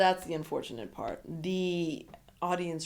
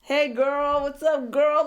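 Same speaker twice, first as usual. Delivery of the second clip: higher, louder laid-back greeting; cool guy greeting; 7 semitones higher, 7 dB louder, slower than usual. Retold or imitated. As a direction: greet her with a smooth swagger. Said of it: "Tyler comes out".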